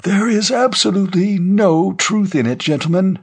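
put-on high-pitched old man's voice; old general, firm denial. Old general answering the rumor on screen, high and insistent. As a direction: deny it firmly in a high elderly voice.

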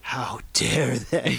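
slow, hammed-up indignation; drawn-out fake offense; mock outrage.

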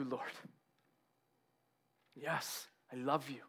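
low, very soft acted reply; hushed confession. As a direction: answer quietly and sincerely. Peter humbly answers Jesus.

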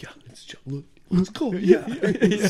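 excited whispered imitation; mock psyched whisper. Imitating two fighters who were jacked to go, excited whisper. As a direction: whisper it like pumped-up henchmen.

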